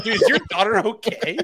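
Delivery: laughing mock concern. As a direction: ask it through laughter. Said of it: "we're like".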